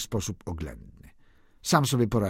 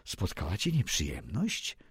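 hushed, lower and softer confidential question; speaking quietly; 5 semitones lower, 8 dB quieter than usual. A narrator's reading. A friend quietly asking Werther about the trouble, hushed. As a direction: lean in and ask under your breath. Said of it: "mówi z cicha".

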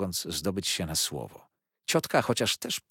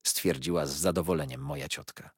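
ordinary delivery

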